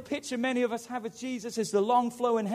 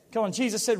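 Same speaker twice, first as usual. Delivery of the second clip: normal talking voice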